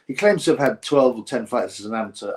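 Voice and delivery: normal talking voice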